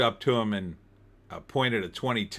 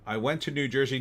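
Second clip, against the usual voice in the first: ordinary talk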